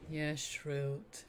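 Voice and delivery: soft, meek obedience; small, submissive reply; chastened underling. Acted. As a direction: answer sheepishly, voice dropped in volume. Chastened Gem obeys.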